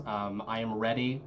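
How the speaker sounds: normal talking voice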